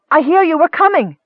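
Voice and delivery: high, loud urgent calling; anguished call. A narrator's reading. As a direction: call desperately into the distance. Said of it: "Nicole called out in anguish".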